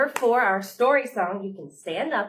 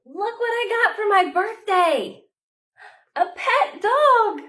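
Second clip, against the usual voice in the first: high, loud and slow, bursting with joy; excited child; 9 dB louder, slower than usual. Acted. Child showing off the birthday puppy, thrilled and loud. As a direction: show off the new puppy, thrilled.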